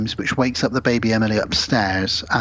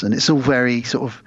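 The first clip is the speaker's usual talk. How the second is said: ordinary talk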